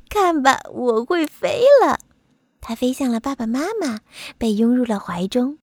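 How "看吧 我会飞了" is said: high, loud, giggly little bumblebee; giggle, then announce it high and loud; excited. A narrator's reading. Bumblebee shows off to his parents, excited. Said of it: "博美尔兴奋地说".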